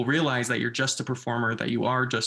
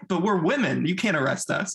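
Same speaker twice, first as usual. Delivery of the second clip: mock indignant women; 6 semitones higher than usual. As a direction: play the pampered women protesting. Retelling the women's protest.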